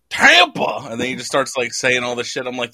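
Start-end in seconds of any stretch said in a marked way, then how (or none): 0.1-0.9 high, loud disbelieving "Tampa?"; mock the roaster's outraged surprise; incredulous echo. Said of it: "He's like".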